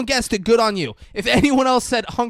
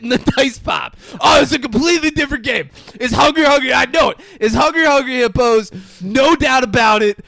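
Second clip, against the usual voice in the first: loud, higher, overconfident know-it-all; mock confident guesser; 6 semitones higher, 9 dB louder than usual. Mocking co-host's guess, loud smug certainty. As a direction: ham up a smug wrong answer.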